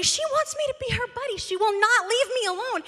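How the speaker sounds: high, fast panicky retelling; rising panic, words tumbling; frantic retold panic; panicked. Panicked retelling of swimming buddy.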